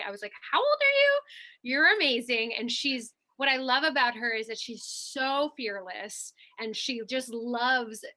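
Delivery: ordinary talk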